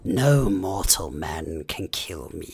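low, slow, menacing dark-lord boom; mock Witch-king. Joking with friends before fighting Sauron, mock dark-lord menace. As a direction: drag it out like an ancient evil.

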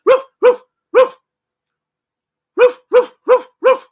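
high, loud yapping dog barks; imitated impatient barks. Telling viewers how his dog barks, high loud mock barking. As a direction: bark it sharply like an impatient dog. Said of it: "he gets impatient".

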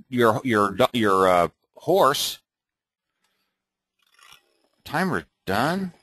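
ordinary delivery

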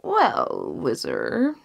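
a deep, weighty king's opening; slow kingly pondering. Acted. King answers the vizier.

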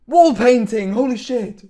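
high, thrilled exclamation; excited. Real, not acted. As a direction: burst out in delighted surprise. Rare pull revealed.

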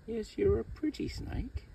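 high, slow coaxing coo; sweet-talking. Real, not acted. Handler coaxing a grumpy snake, sweet-talking.

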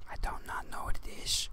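hushed whisper. Real, not acted. Speaker disowning knowledge of New York City, whispering. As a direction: drop right down into a whisper.

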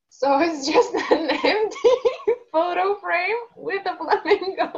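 real amused laughter while telling it; laughing. Real, not acted. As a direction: giggle the whole line out. Laughs at own story.

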